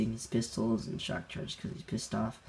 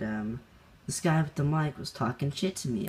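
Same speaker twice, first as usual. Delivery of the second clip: ordinary talk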